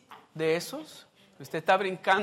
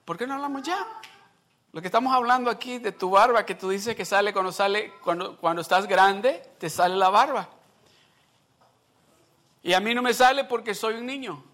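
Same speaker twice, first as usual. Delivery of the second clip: put-on higher, louder bratty kid talking back; sassy boy; 4 semitones higher, 4 dB louder than usual. A sarcastic son talks back to his father. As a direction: argue back like a smart-mouthed boy. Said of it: "Y que lo mire y dice".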